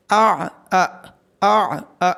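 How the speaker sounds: آ with a glottal jolt, then from mid throat; throat sound drill. Shown as a demonstration. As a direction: contrast hamza and ain. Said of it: "حمزہ کی آواز آ جھٹکے کے ساتھ".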